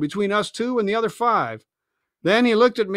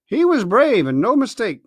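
ordinary delivery

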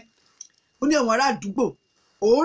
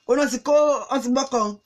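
ordinary delivery, nothing marked

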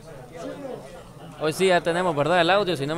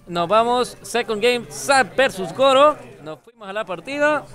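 hype announcer; 5 semitones higher, 5 dB louder than usual. Real, not acted. Announcing the match start.

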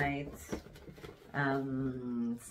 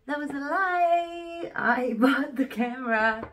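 playful confession; 9 semitones higher, 5 dB louder than usual. Real, not acted. Confessing the camera purchase.

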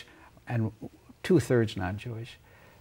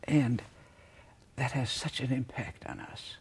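normal talking voice

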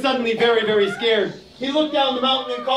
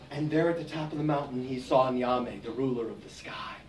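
much lower and softer, in awe; hushed awe; 11 semitones lower, 10 dB quieter than usual. A narrator's reading. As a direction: drop to a hush at the summit.

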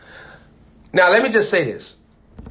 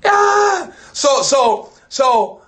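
high ringing cry, then louder calls of so; preacher's shout; 10 semitones higher, 3 dB louder, much slower than usual. Real, not acted. Preacher crying out to the saints in full fervor. Preacher erupting mid-sermon.

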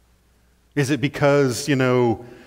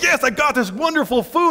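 high, delighted cry of triumph; thrilled; 9 semitones higher than usual. Acted. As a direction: crow over the catch like a greedy fish. Fish grabs the bait, thrilled.

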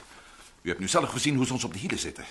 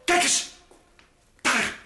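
loud, rising higher with each cry; excited shout; 8 dB louder, slower than usual. Acted. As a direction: point him out in alarm. Spotting Curtis. (opgewonden, steeds hoger roepend)

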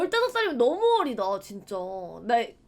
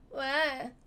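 cowed answer; 4 dB quieter than usual. Retold or imitated. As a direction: act out a kid answering mom timidly. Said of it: "그 이제 무서우니까". (무서워서 작게 대꾸하는 아이 흉내)

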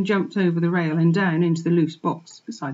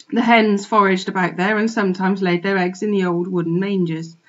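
ordinary delivery, nothing marked

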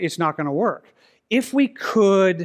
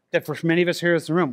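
ordinary talk, nothing marked

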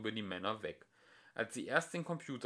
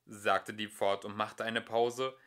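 usual speaking voice

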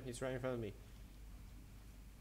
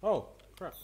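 surprised; 6 semitones higher, 8 dB louder than usual. Real, not acted. Sudden surprise in game.

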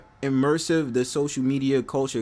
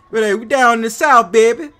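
put-on New Orleans accent; 11 semitones higher, 11 dB louder than usual. New Yorker imitating New Orleans locals, loud high Southern accent. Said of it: "New Orleans be seeming like".

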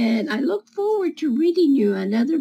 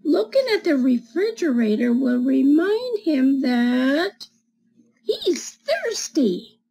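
put-on reading to children; slower than usual. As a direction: read it like storytime for little kids. Reads picture book to children.

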